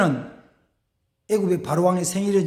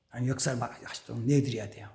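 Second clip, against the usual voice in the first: ordinary delivery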